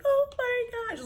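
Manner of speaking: very high, stretched squeal; flipping out. Retold or imitated. Seeing the adorable new relatives, high overwhelmed squeal. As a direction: squeal it slowly as if overcome. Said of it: "I was just flipping out".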